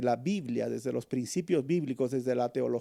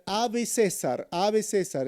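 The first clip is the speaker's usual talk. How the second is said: hailing Caesar; 7 semitones higher, slower than usual. Retold or imitated. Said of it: "porque decían".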